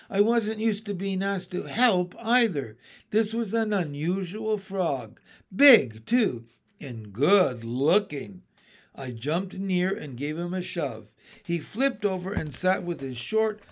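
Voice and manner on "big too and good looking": ordinary delivery, nothing marked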